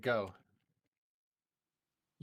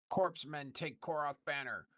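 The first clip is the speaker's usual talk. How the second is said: ogre voice; 7 semitones higher, slower than usual. Acted. Ogre voice for quest text.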